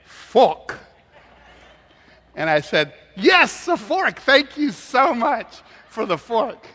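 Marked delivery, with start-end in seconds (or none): 0.0-0.8 put-on loud, higher Chinese-accented "Fork" with no R; emphatic Chinese-accented announcement; proud waitresses, imitated. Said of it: "And they say". 3.2-6.7 high, loud, drawn-out mock thanks; hammed-up high-pitched delight; overdone gratitude. Retold mock thanks for wrong item.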